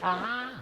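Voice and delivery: a quick squeal of pain from a hair pull; a small sharp hurt cry; imitated cry of pain. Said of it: "and she's like".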